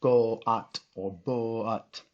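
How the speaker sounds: slow goat and boat split as go at and bo at; wrong split. Shown as a demonstration. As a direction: drag out goat and boat in two parts, go at and bo at. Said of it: "we don't say".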